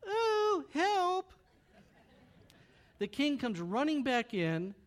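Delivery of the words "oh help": high, hammed-up little yelp for help; mock cry for help. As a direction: squeak it out like a startled queen. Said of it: "Esther must have gone".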